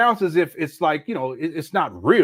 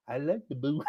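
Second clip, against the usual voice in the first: a soft, swooning imitation of a smitten woman, much softer; mock lovestruck woman; 4 semitones lower, 9 dB quieter than usual. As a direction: sigh it out like she's been won over. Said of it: "but a boo".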